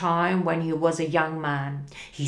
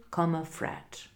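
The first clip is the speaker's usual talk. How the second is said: ordinary delivery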